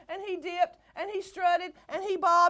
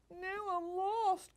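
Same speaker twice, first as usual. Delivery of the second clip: forlorn; 6 semitones higher, 5 dB quieter, slower than usual. A narrator's reading. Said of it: "cried Beaky".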